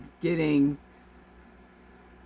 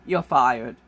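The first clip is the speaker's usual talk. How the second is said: mock firing line; 7 semitones lower, 4 dB louder than usual. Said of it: "to hear someone say that to you".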